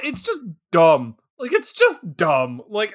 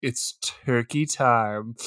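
slow, lower, hammed-up seduction; mock sultry line; 7 semitones lower, 6 dB quieter, slower than usual. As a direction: drawl it out like a cheesy come-on. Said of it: "just lie on her back and turn to him and go".

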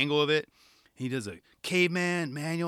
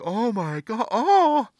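high "oh my God" rising to a loud cry; imitating shocked onlooker; 8 semitones higher, 6 dB louder, much slower than usual. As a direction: mimic someone aghast, hands on face. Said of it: "had their hands on their face".

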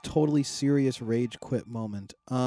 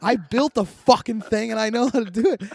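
high, loud re-enacted rant; indignant outburst acted out for a friend; retold scream; 10 semitones higher, 5 dB louder than usual. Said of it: "Like, I screamed".

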